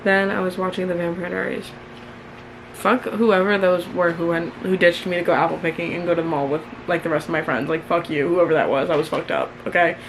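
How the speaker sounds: normal talking voice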